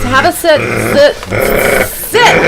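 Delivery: insistent command to a pet; dog command. Acted. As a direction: order a dog to sit, rising in pitch. Ordering the wolfman like a dog.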